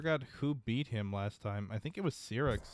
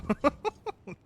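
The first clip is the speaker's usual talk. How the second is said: a sudden high laugh; high burst of laughter; 4 dB louder than usual. Real, not acted. Laughing at player's kill.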